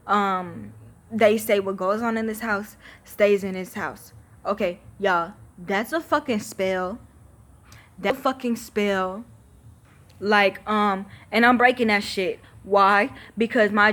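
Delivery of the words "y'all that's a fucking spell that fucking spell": ordinary talk, nothing marked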